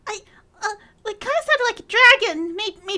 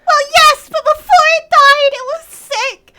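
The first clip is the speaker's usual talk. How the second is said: very loud, raised-pitch protest; defensive outburst; 5 semitones higher, 12 dB louder than usual. Acted. Cafe owner defending the diagnosis, defensive outburst. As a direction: argue back loudly, insisting. Acted argument back.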